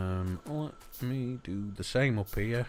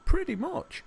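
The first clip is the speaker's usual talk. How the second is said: very high, louder squeak on pretty much; a silly falsetto echo, much higher and louder; squeaky mock repeat; 6 dB louder than usual. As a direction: squeal the words back at yourself in fun. Squeaky repeat of own phrase.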